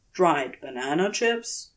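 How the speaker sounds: ordinary delivery, nothing marked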